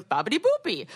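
bouncy, lightly hammed-up Italian accent, stressing Boopy; a playful jokey Italian accent on "Babidi Boopy"; mock Italian accent. Friend mocking a restaurant name in a jokey Italian accent. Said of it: "a joke of an Italian accent of somebody going".

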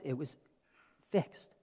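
ordinary talk

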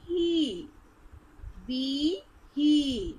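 "hi, bi, hi" with each I held long; little long accent. Shown as a demonstration. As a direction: stretch every syllable to "hee, bee, hee". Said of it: "read in a little long accent".

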